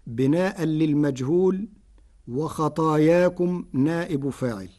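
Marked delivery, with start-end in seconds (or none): none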